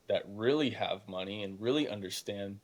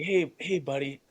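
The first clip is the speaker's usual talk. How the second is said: imitating teacher; 5 semitones higher, 4 dB louder than usual. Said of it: "He would come over to me and say".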